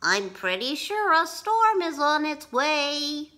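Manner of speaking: high, earnest warning voice; Henry warning Calico Jack; worried bunny. Acted. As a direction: warn them gently but sure. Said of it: "said Henry".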